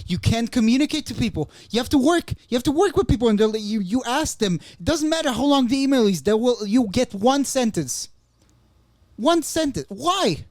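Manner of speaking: high, loud exasperated rant. Real, not acted. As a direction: vent every grievance at full pitch. Ranting about American emails.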